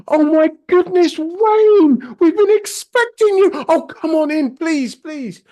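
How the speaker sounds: very high, loud, sing-song hostess voice; gushing posh lady. Retold or imitated. Impersonating the homeowner greeting Wayne, high gushing voice. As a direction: gush over the visitor in a high swooping voice. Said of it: "She's like".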